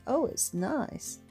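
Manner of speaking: normal talking voice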